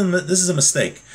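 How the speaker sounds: normal talking voice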